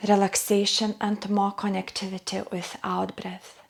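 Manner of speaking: soft, hushed, partly whispered breath guidance; guided breathing meditation. Acted. Yoga teacher guiding closed-eye breathing, soft hushed meditation voice.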